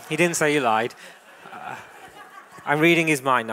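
loud, laughing self-correction; amused protest. Real, not acted. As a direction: laugh out loud while setting it straight. Laughing off her own mind-reading.

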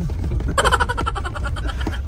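squealing giggle; high giggle. Real, not acted. High laugh.

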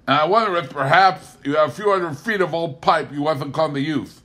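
Pa cadging pipe and lumber from Bob; Pa Kettle voice. A narrator's reading. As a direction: ask for handouts slyly. Pa asking for pipe.

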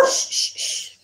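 soft repeated shushes; hushing. Real, not acted. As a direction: shush the puppy softly. Hushing the puppy.

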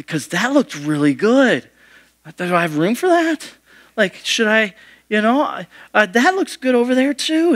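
rising, eager voice of a hungry diner; greedy tempted diner. Retold or imitated. Tempted diner eyeing more food.

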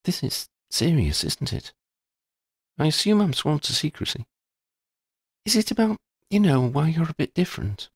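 put-on a Ravenclaw girl asking gently if it's serious; lifted young voice for Shona; Shona, curious schoolgirl. A narrator's reading.

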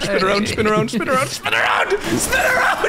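rising frustrated shouts of spin around; building to a high frustrated yell; frustrated urging. Real, not acted. Frustrated repeated commands.

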